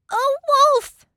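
very high, loud pig wail; frightened cry. A narrator's reading. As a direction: wail out the alarm. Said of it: "Pig cried".

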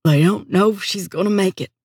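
lightly choked-up uncle; a touch of held-back grief; holding back sobs. A narrator's reading. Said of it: "take a strangled breath, trying to cut off a sob".

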